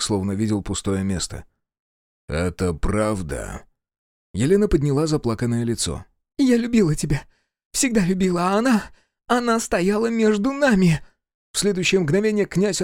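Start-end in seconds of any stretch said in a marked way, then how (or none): 2.3-3.6 slow, low question; cold prince. A narrator's reading. Prince asks his wife for truth, coldly. 6.4-11.0 high-pitched, pleading woman's voice; tearful pleading wife. A narrator's reading. Tearful wife confesses to her husband, pleading.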